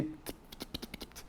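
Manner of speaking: whispered t t t like gunfire; gunshot clicks. Shown as a demonstration. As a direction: tap out quiet tiro sounds. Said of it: "é um barulho de tiro". (estalos sussurrados como tiros)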